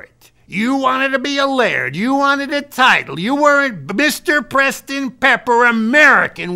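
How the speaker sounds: loud, high-pitched dressing-down; angry dad telling off his son; scolding father. Retold or imitated. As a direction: lecture a grown son, loud and indignant. Acted quote of scolding dad.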